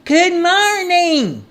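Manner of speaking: high, stretched-out call. A narrator's reading. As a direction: holler it slowly to be heard. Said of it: "he shouted a bit louder".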